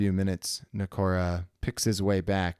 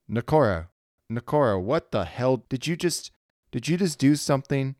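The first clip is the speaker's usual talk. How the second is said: alarmed; 6 semitones higher, 5 dB louder than usual. Acted. Petra alarmed over comms.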